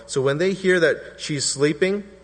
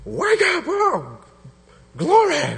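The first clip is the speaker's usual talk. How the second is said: much higher, loud jolting wake-up call; a rough shout to wake a sleeper; harsh wake-up; 4 dB louder, slower than usual. Shown as a demonstration. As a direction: blast someone awake. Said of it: "This isn't like".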